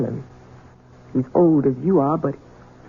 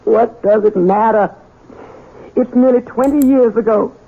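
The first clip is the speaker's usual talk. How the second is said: anguished outburst; 5 semitones higher, 4 dB louder than usual. Acted.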